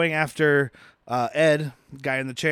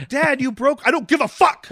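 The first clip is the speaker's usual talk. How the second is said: higher, louder exasperated outburst; angry dad routine; 9 semitones higher, 4 dB louder than usual. Acted. Angry father packing for a trip he dreads, exasperated. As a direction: play a fed-up dad packing the car. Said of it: "like an angry father packing".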